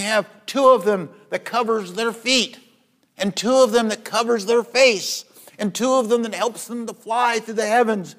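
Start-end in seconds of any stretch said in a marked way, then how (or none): none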